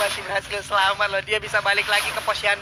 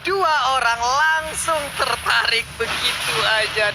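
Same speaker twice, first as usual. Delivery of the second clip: excited; 2 semitones higher, much slower than usual. Real, not acted. Caster drawls about Ruby's pull.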